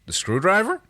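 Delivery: high, rising surprised question; taken aback, pitch lifting to the end. A narrator's reading. John looks up at Erin, surprised by her question. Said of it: "John looked up at her in surprise".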